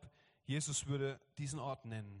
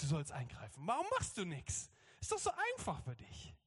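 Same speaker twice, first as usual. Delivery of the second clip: high mock nagging, swooping upward; little girl crying up; 4 semitones higher than usual. Believer demands Jesus step in, high childish scolding. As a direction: play a small girl telling off an adult. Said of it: "wie so ein kleines Mädchen, was so hochschreit".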